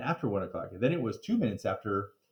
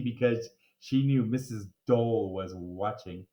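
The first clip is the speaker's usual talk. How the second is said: usual speaking voice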